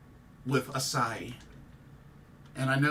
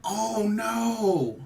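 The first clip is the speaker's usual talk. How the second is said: high, loud anguished "oh no"; a breaking wail of disbelief; distressed cry; 9 semitones higher, 5 dB louder, much slower than usual. Real, not acted. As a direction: cry out in real shock and grief. Shocked by news of a death.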